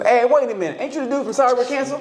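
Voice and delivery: high, quick excited fan voice; fan on the street. Retold or imitated. Imitating a fan stopping him in Indianapolis, high and quick. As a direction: play a starstruck fan spotting him. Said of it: "They go".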